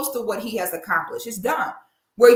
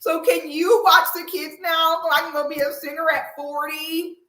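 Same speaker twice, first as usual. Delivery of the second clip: high, loud, sing-song mock of a self-absorbed wife; mocking Monique; 7 semitones higher, 4 dB louder than usual. Host mocking Monique asking husband to watch kids, hammed-up high whine. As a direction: lampoon her demand with a hammed-up high voice. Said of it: "It was her saying".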